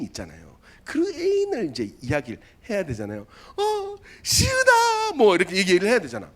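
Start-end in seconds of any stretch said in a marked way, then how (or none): 3.6-5.1 long, high drawn-out call; calling out a name. Retold or imitated. Recruit calling his girlfriend's name, long high call. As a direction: call her name across the field.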